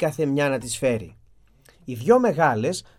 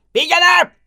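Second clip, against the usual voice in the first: Πήγαινε! roared much higher and louder; roaring command; 13 semitones higher, 9 dB louder, slower than usual. Acted. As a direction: bellow Πήγαινε! like a monster. Beast dismisses merchant.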